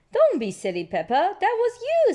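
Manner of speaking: put-on light high teasing child; Susie's voice. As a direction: tease your friend. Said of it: "says Susie".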